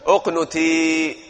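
teacher modelling اقنتي slowly; drawn-out demo. Shown as a demonstration. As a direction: stretch اقنتي very slowly, syllable by syllable. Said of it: "الآن جاوبنا نحن".

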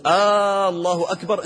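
long chanted "آ" at the start of "الله أكبر"; "آه، الله أكبر" with the opening vowel held; mistaken questioning opening. Shown as a demonstration. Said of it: "أنت تسأل".

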